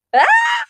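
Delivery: loud high yell; a sudden alarmed shout; sharp shout. Real, not acted. Shout at child.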